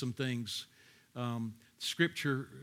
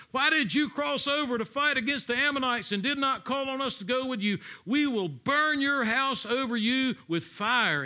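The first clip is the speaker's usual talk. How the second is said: higher, louder accusing challenge; angry threat; 10 semitones higher, 4 dB louder than usual. Acted. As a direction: read it out as an indignant threat. Reading out the Ephraimites' threat.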